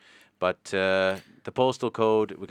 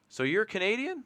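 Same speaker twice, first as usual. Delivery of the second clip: surprised wrestler; 12 semitones higher than usual. Retold or imitated. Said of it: "And he's like".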